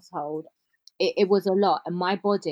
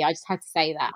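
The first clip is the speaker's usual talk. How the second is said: ordinary delivery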